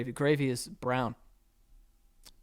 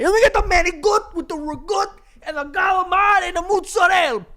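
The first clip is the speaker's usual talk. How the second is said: loud, high Italian-American food order; over-the-top Italian-American; 8 dB louder than usual. Acted. Showing how he won't order, shouted Italian-American accent. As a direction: holler the dishes in a hammed-up Italian-American accent. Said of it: "I'm not gonna do that".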